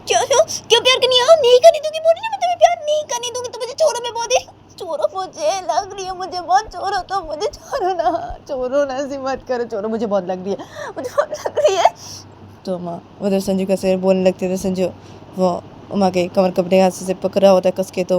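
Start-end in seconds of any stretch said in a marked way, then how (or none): 0.1-11.9 very high protest sinking into a whimper; distressed Uma refusing. Acted. (तीखे इनकार से रुआँसी मिन्नत तक)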